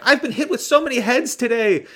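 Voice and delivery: high-pitched, fed-up complaint; exasperated Bridget Fonda. Retold or imitated. Bridget Fonda, fed up with heads hitting her, high whine. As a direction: squeal it like she's had enough. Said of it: "she's like".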